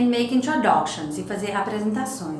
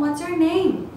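higher, stressed "What's your name?"; announcing the lesson title; emphasized title; 8 semitones higher, 3 dB louder, slower than usual. Shown as a demonstration. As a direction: read the title out boldly. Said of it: "grandão aqui".

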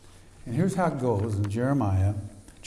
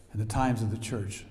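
usual speaking voice

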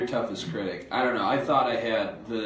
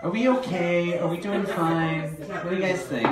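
higher, louder playful question; excited; 9 semitones higher, 5 dB louder than usual. Real, not acted. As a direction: ask the crowd with bright energy. Asking the audience, laughing.

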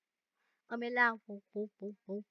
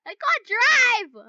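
wailing panic; 10 semitones higher, 8 dB louder, much slower than usual. Real, not acted. Laughing panic driving.

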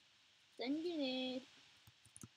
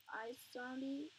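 ordinary delivery

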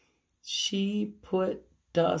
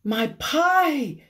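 higher, louder sudden cry; alarmed exclamation; 9 semitones higher, 6 dB louder than usual. A narrator's reading. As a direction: gasp it out remembering the pie. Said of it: "exclaimed Amelia Bedelia".

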